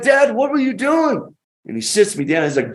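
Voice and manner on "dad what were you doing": startled shout. Retold or imitated. Son confronting dad after shower.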